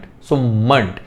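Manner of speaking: usual speaking voice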